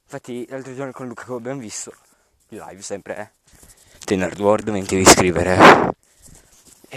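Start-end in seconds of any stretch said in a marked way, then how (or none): 4.0-7.0 loud. Real, not acted. Joking channel plug.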